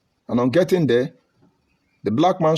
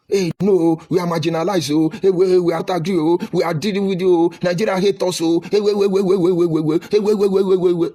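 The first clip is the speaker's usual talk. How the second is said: mock complaining Igbo; 6 semitones higher than usual. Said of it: "Igbo will jump up".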